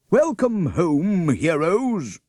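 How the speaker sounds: mayor hailing the class as heroes, booming. A narrator's reading. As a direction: greet them like a proud mayor. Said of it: "said the mayor".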